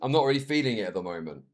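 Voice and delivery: low, soft imitation of an offhand admission; mild, low-key quoted remark; casual understatement. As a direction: play it down, low and quiet. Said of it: "He was just like".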